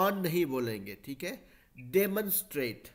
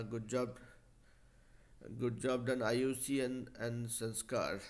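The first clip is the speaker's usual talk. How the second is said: ordinary talk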